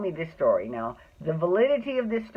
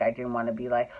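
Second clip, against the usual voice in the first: ordinary talk, nothing marked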